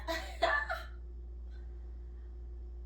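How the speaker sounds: high breathless disbelieving laugh; shocked high laugh. Real, not acted. Vlogger shocked by the book's twist, high breathless laugh.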